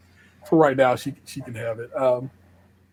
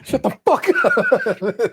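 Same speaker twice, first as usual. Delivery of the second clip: amazed disbelief; 8 semitones higher, much slower than usual. Real, not acted. Reacting to friend's story.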